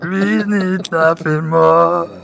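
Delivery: high, loud, slow sung impression; imitating a singer. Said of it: "I used to be able to do his voice".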